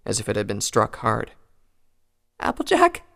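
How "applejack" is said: high, hesitant disbelieving voice; unsure whether she's really there; confused high call. Acted. Rainbow wakes, confused.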